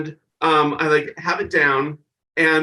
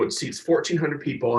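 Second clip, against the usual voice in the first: normal talking voice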